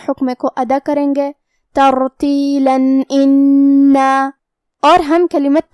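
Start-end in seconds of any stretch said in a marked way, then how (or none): 1.8-4.1 ترتیلا انا with the tanween said as a clear نون, no nasal hum, very slow; slow ترتیلا انا, clear unnasalized نون before انا; izhar demo. Shown as a demonstration. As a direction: recite ترتیلا انا slowly, sounding the tanween as a plain نون. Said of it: "تنوین ظاہر کریں گے اور اسے نون ساکن پڑھیں گے".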